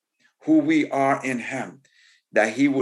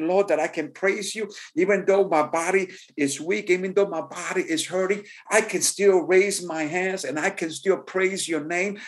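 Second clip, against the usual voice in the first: higher-pitched, fervent spoken prayer; 7 semitones higher than usual. Acted. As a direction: pray aloud to God, lifting with each thanks. Preacher leads a spoken prayer.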